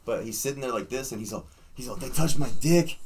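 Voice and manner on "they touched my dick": high, loud amazed announcement; imitating a thrilled friend; retold excited outburst. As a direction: blurt it out like astonishing news. Acting out friend's excited report.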